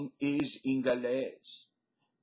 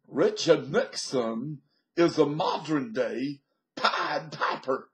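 slow, loud preacher voice; Billy Graham impression; 3 semitones higher, 7 dB louder than usual. Vlogger imitates Billy Graham describing Nixon, slow, loud preaching. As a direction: intone it like a revivalist preacher from the pulpit. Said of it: "Think of Billy Graham".

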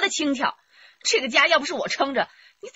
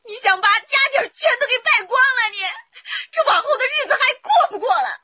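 higher, tearful pleading; tearful protest; 7 semitones higher than usual. Acted. Wife protests the lost savings, tearful and higher. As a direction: beg him not to waste everything.

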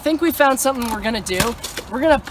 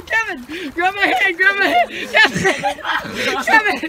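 calling out; 6 semitones higher than usual. Real, not acted. Friend falling through ice.

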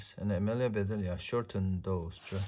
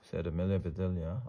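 ordinary delivery

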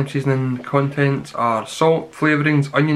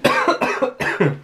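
sudden harsh cough from spice; coughing fit; 6 semitones higher than usual. Real, not acted. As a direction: cough on the chili heat. Chili crisp catches his throat.

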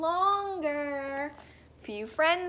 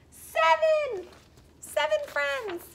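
much higher, slow squeaky numbers; playful squeak for little listeners; squeaky count; 13 semitones higher, 5 dB louder, slower than usual. Acted. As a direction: stretch the last count up high.

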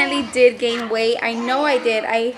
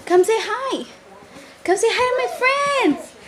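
higher sing-song calling; calling the dog; 7 semitones higher than usual. Real, not acted. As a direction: coax the dog over.

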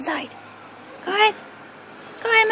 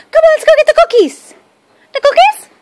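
high, bright-pitched praise for the dog, a bit louder; excited dog praise; 8 semitones higher, 4 dB louder than usual. Real, not acted. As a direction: cheer the dog on in a sing-song voice. Luring the dogs with cookies.

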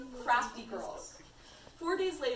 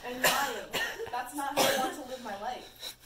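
laughing while reading; 5 dB louder than usual. Real, not acted. Reading through giggles.

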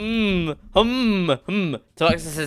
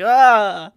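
mock startled yell; 7 semitones higher, 7 dB louder than usual. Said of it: "He's like".

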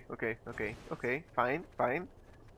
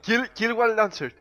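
loud, high urging; urgent call-out; 6 semitones higher, 7 dB louder than usual. Real, not acted. Player condemning a team killer, urgent call-out. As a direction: order the team loudly to attack.

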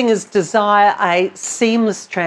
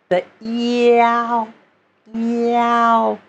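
slow stretched meow; 3 semitones higher, much slower than usual. Shown as a demonstration. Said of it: "stretch this whole sound out".

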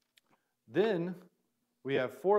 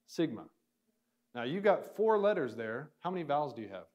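ordinary delivery

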